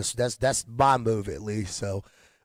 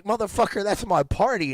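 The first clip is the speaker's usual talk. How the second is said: heated retold outburst; 6 semitones higher than usual. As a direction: pitch jumps up, indignant snap. Retold clash with security guard.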